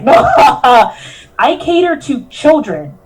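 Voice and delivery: laughing through a retold retort; incredulous laugh mid-quote. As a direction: laugh it out in disbelief. Mocking the dress-up meeting.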